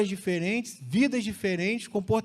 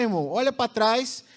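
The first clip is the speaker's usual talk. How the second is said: usual speaking voice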